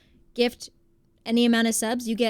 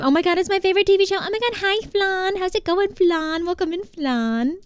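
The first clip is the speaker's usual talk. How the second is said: high-pitched gushing welcome; excited greeting; 8 semitones higher than usual. Real, not acted. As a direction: squeal hello to a favorite guest, high and thrilled. Gushing welcome to arriving viewer.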